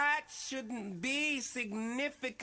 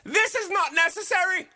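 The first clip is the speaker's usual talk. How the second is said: sharp, raised scolding; indignant; 6 semitones higher, 8 dB louder, faster than usual. Acted. Host scolding the Rasta guest, indignant and loud. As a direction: shut the argument down, loudly.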